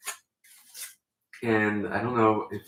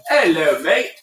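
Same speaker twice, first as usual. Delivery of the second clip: put-on British accent; 4 semitones higher, 7 dB louder than usual. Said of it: "Can we go British accents only".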